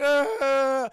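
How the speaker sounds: high, loud fake wail; fake crying. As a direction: bawl like a kid working up tears. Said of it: "He starts crying".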